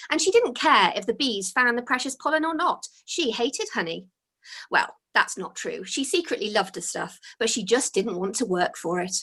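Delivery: usual speaking voice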